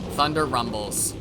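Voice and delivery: ordinary delivery, nothing marked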